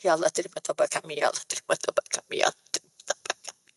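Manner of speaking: low, fast, mumbled mock prayer fading into breath; tearless perfunctory repentance. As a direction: rattle off a rote prayer under your breath. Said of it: "taubatnya tak nangis pun".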